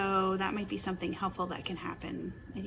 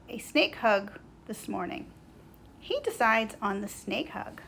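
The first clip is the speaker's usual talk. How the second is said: lively, louder storybook narration; children's story reading; 3 semitones higher, 9 dB louder than usual. A narrator's reading. Narrator reading Schubert's morning to children, lively storytelling. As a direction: read it out brightly for little listeners.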